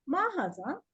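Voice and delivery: "मा हाजा" lifted with a rising lilt; questioning tune. Shown as a demonstration. Teacher shows how to ask a question, questioning tune. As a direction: ask "मा हाजा" curiously, ending up. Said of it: "थोड़ा सा इस तरह से करेंगे".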